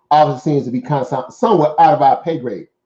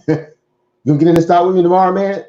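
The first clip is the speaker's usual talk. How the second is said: fast, louder, mock-eager pitch; pushy hype friend; 4 semitones higher, 4 dB louder, faster than usual. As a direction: rattle it off like a guy selling you a hot stock. Imitating a pushy stock hypeman.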